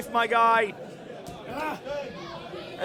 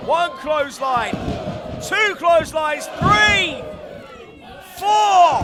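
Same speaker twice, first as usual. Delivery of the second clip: louder and higher with each number; building count; 3 semitones higher, 4 dB louder than usual. Real, not acted. Counting clotheslines, building excitement.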